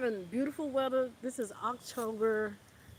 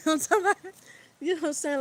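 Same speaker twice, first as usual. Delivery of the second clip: laughing; 6 semitones higher, 8 dB louder, much faster than usual. Real, not acted. Laughing mid-sentence.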